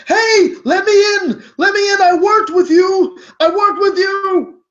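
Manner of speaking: high, loud desperate plea from behind a door; screaming. Acted. Banging on the door to be let in, screaming. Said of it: "he's screaming and he's like".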